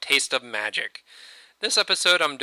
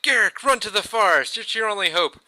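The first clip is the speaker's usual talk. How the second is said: higher, pressing cry to flee; urgent plea; 8 semitones higher than usual. Retold or imitated. Father tells his son to escape, a mock urgent plea. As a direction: urge him to run for his life. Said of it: "So Garruk's dad is like".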